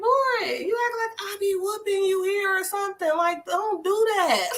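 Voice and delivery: put-on high, playful scolding of a little boy; mock scolding a boy. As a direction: tease the kid like a mock-stern caregiver. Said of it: "I be like".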